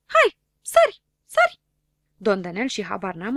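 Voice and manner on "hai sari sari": extremely high, loud shrill urging; a voice yelling at someone to jump; urging shout. Acted. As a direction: egg him on to jump.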